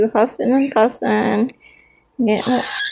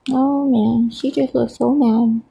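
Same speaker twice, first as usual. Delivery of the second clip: ordinary talk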